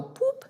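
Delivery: high-pitched little "boop"; tiny high beep made by voice; spoken boop sound. Shown as a demonstration. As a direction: pop out a high boop like a button press. Imitating a click on the video.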